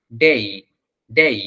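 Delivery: "ডেই" with e gliding up into i; correct diphthong. Shown as a demonstration. Teacher repeats the right vowel, correct diphthong in "ডেই". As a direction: say "ডেই" separately, finishing the glide. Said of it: "ওটা হচ্ছে ডেই".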